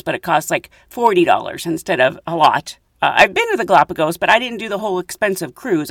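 ordinary talk

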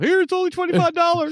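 high, proud bargain hunter voice. Retold or imitated. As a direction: show off the cheap find. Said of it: "I was all proud of myself".

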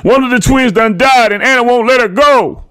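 very high, loud urgent report; frantic phone shout. A narrator's reading. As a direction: shout the news down the line. Frantic phone call to Sandra.

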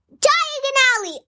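high shouted call of a place name. A narrator's reading. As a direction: yell Diagon Alley out at the top of his voice. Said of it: "at the top of his voice".

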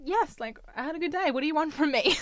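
fed up, throw it back at her; exasperated boyfriend. Retold or imitated. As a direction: boyfriend defending himself against his girlfriend. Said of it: "And the guy's like".

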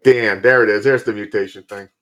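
loud retold movie-watching reaction; retold outburst. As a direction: blurt it out loud, recounting your reaction. Retelling his startled reaction to the film.

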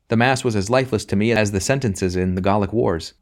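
plain reading voice

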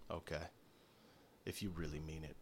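low and very soft; grudging acceptance. Acted. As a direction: accept the apology barely aloud. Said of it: "And he kinda s".

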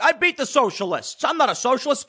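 a hint of doddering Biden denial; a passing mock Biden impression. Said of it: "Joe Biden's like".

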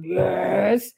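burp the word out; mock belch shaped into a word; imitated burp-talking. Friend demonstrating Louise's burp on location, burped word. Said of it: "that was her burping and talking at the same time".

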